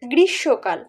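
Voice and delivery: "গ্রীষ্মকাল"-এ ম বাদ, "গ্রিশ্শোকাল"; সঠিক বাংলা উচ্চারণ. Shown as a demonstration. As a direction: say "গ্রীষ্মকাল" as grishshokal, dropping the m. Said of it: "বলি". (ম ছাড়া "গ্রিশ্শোকাল")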